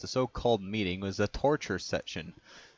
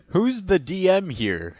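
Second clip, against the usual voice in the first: higher, louder and slower indignant yell; screaming; 9 semitones higher, 7 dB louder than usual. A narrator's reading. Alex screams at the complaining intern. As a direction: demand it like you're in charge. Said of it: "screams Alex".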